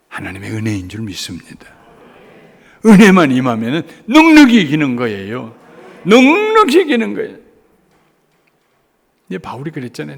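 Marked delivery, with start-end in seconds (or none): 2.8-5.6 loud, stress on 넉넉히; fervent preaching. Acted. Preacher proclaiming victory by grace, fervent. 6.0-7.4 much higher, louder shout on 넉넉히; soaring preaching cry. Acted. Preacher repeating the promise to the congregation, soaring shout.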